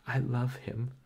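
high, loud sobbing cry. A narrator's reading. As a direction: plead through tears. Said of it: "she sobbed".

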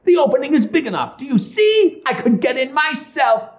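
high-pitched, impatient witch; exasperated witch. Acted. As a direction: show her up in a high witch voice.

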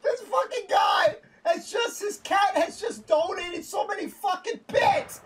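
high, helpless laughing rant; half shout, half laugh in disbelief; laughing exasperation. Real, not acted. Streamer telling viewers about the cat's donation, laughing exasperation.

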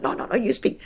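hushed, hurried refusal; softly mimed back-and-forth, as if mouthed; miming. Retold or imitated. Co-host refusing to speak on air, miming it back.

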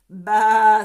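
loud, drawn-out baa; sheep bleat. A narrator's reading. Sheep joining the farmyard chorus, loud bleat. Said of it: "said the sheep".

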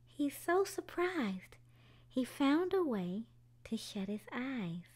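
gentle storybook narration for little ones; children's story time read-aloud. A narrator's reading. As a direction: tell it like a bedtime story. Reads a picture book aloud.

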